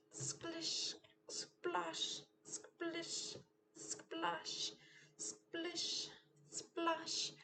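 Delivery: quiet sing-song splashing; hushed splish splash. Acted. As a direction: tiptoe through the mud in a hush. Sound words for mud.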